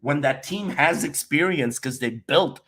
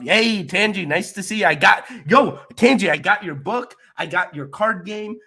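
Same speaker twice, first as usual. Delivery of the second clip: higher, louder delighted hello; raised pitch, loud and eager; excited greeting; 7 semitones higher, 5 dB louder than usual. Real, not acted. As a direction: greet a friend with bursting excitement. Excitedly greets a viewer in chat.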